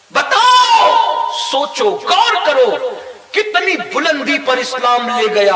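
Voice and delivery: slow thundering appeal from the pulpit; preaching shout. Real, not acted. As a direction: call out to them slowly, full force. Preacher challenging the crowd.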